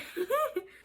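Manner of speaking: short high giggle; quick, high-pitched laugh; amused giggle. Real, not acted. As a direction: giggle at your own joke. Laughs at her own reaction.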